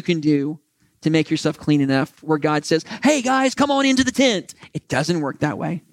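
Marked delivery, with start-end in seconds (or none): 3.0-4.5 higher, cheery mock God voice; lightly voiced welcoming host. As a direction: play God waving them in, high and bright.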